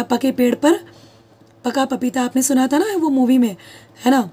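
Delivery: usual speaking voice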